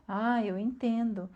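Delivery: very soft, slow, understanding reply; compreensão. Acted. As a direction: soothe them quietly, taking your time. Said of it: "é bem diferente". (compreensivo, bem baixinho e devagar)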